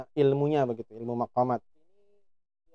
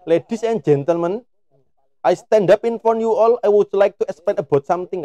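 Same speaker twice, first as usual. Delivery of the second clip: a hammed-up speech in Javanese-accented English, higher; mock a Javanese speaker's formal English, louder; 6 semitones higher, 5 dB louder than usual. Shown as a demonstration. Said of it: "Nggak pantas kemudian ngomong". (pidato Inggris berlogat Jawa yang dibuat-buat)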